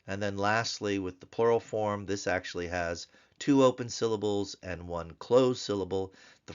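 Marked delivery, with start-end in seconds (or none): none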